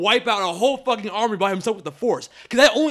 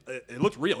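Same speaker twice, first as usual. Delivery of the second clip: ordinary talk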